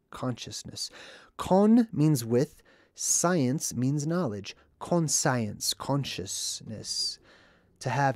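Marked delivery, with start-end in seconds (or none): 0.1-0.9 breathy whisper of the word. Real, not acted. Vlogger whispering the word for emphasis, much softer. 4.8-7.2 slow whispered word. Real, not acted. Vlogger whispering the word slowly, softer.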